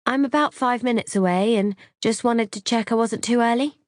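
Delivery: high, light telephone check-in; polite phone voice. A narrator's reading. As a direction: pitch it up politely for a phone call. Polite phone call in dialogue.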